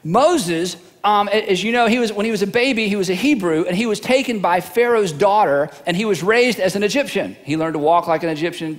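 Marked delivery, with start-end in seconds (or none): none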